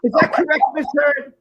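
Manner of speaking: hammed-up cross-examiner; mock lawyer. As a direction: grill her like a courtroom lawyer. Repeats the lawyer's question louder.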